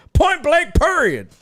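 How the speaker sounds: high-pitched sassy imitation; mocking voice. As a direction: parody the annoying people who say it. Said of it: "I hate people that say that shit".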